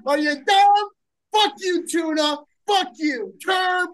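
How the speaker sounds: high, hyped mock-insulting intro; hype-man welcome. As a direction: roast the guest in a pumped announcer voice.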